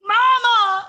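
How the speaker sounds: high, loud tearful cry of Mama; crying out for mom. Retold or imitated. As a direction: call out for mama through happy tears. Winner crying out to her mom.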